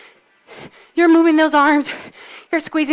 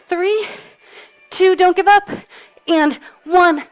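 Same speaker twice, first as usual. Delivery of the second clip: higher and louder, urging them not to quit; energetic countdown; 6 semitones higher, 3 dB louder, slower than usual. Real, not acted. Coach urging tired class not to give up, rallying shout. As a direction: push them hard through the last seconds.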